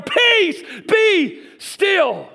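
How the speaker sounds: high, loud, commanding cry; rebuking shout. Acted. Jesus rebuking winds and waves, high commanding shout. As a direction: shout it as an order to the storm. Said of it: "he rebukes the winds and the waves".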